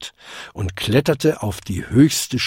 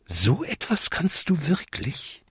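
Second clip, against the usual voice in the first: leises, hohes Koboldstimmchen; staunende Frage; 4 semitones higher, 7 dB quieter than usual. A narrator's reading. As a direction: frage leise und ungläubig nach. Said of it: "fragte er". (ungläubig, mit leiser Stimme)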